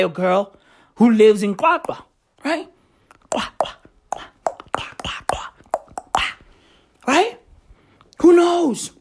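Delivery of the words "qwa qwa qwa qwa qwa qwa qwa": breathy whispered Qwa chant. Pretend. Streamer whispering a mock chant of Qwa.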